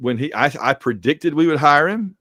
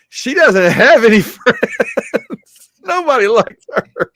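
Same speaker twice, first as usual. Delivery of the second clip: loud, high teasing cry, breaking into laughter; mock-mean teasing through laughter; laughing mock outburst; 9 semitones higher, 5 dB louder, slower than usual. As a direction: blurt the joke out high and loud, cracking up. Retold teasing of a friend.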